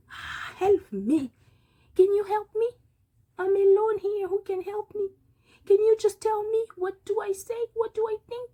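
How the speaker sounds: high, loud cries for help; pleading character. Acted. Lone character begging for help, high and loud. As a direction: beg anyone around to help you.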